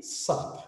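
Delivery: only "sap", first syllable dropped; bare one-syllable "sap"; clipped short form. Shown as a demonstration. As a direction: cut it down to a quick "sap". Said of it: "E aqui".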